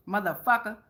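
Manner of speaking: normal talking voice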